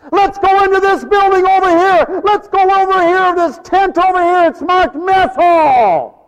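high, loud holler to companions; calling out. Acted. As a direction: shout it out, stretching the last words. Leper calling to his companions.

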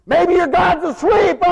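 loud, high taunting shout; jeering. Acted. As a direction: yell mockery at the false prophets. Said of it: "he jeered him".

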